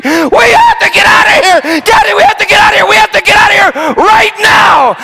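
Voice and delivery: put-on high, shouted panicked child's plea; terrified child screaming. Daughter begs her father to get out, screaming in terror. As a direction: scream it as a frightened little girl. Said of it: "She just started screaming".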